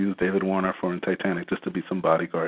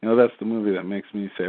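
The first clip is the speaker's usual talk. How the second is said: usual speaking voice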